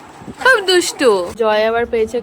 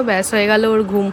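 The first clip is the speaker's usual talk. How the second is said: normal talking voice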